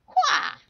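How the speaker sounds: high, loud comic battle cry; throw yell. Acted. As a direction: yelp it as you throw. Throwing sound effect.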